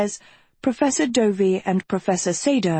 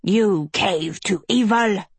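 slowed, accusing outcry; indignant cry; slower than usual. A narrator's reading. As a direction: hurl the accusation. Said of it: "Professor Espada cried".

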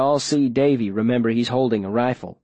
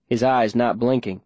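plain reading voice

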